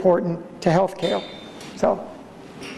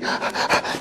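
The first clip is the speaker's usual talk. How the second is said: a sharp breathless intake; gasp; 4 dB quieter than usual. Shown as a demonstration. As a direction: suck in air like a struggling patient. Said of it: "labored breathing".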